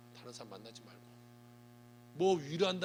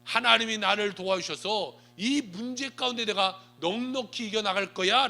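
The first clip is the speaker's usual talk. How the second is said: loud, slow confident proclamation; slow, ringing vow of faith; bold declaration of faith; 5 semitones higher, 10 dB louder, slower than usual. Shown as a demonstration. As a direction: declare victory with full force. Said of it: "라고 말하는 자가 되어야".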